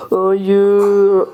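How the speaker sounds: a loud, drawn-out despairing no; anguished cry. Real, not acted. Gamer failing the jump, loud anguished no. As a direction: cry out as the run fails.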